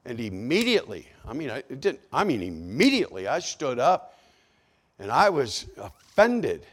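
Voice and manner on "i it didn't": ordinary delivery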